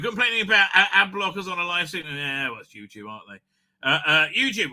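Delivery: ordinary talk, nothing marked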